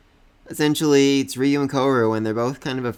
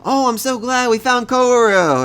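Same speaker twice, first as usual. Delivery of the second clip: put-on much higher, louder, slow gushing girl voice; excited Estelle; 12 semitones higher, 6 dB louder than usual. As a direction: squeal it up high like a relieved girl. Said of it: "Estelle's like".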